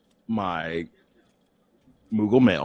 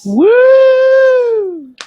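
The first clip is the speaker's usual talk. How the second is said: very high shouted whoop; cheering woo; 7 dB louder than usual. Acted.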